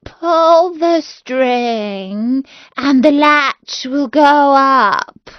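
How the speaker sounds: wolf drawing it out slowly, faking grandma; the wolf trying to sound like grandmother, very slow; wolf luring the girl in, slow and put-on; wolf softening his voice. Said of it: "softening his voice as much as he could".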